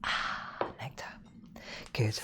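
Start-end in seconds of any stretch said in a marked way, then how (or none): 0.0-1.0 soft breathy sigh of pleasure after a sip; savouring whisper. Real, not acted. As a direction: whisper it as if tasting something delicious. Sipping tea, savouring it.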